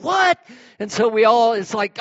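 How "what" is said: high, loud disbelieving "What?"; shocked what. Retold or imitated. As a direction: bark out a stunned "What?". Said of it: "It was like".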